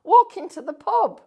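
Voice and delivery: loud, high shout back; a stranger yelling back across the garden. Retold or imitated. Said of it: "this bloke shouts back".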